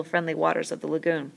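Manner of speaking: ordinary delivery, nothing marked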